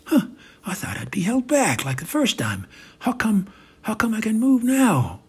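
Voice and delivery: growing astonishment; surprised. Acted. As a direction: build from a small huh to a high, baffled question. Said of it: "is surprised he's able to do it".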